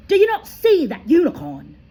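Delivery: a loud, high, furious bellow. A narrator's reading. Firenze bellowing at Bane in anger, loud and high. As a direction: roar it at him. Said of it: "Firenze bellowed at Bane".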